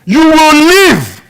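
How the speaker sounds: loud, high preaching, driving every phrase; preaching at full force. Acted. Pastor preaching to the church, loud and driving. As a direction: preach it out over the congregation.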